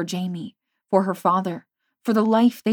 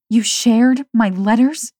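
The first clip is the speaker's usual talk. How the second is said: shocked; 4 semitones higher, 9 dB louder, slower than usual. A narrator's reading. She felt violated.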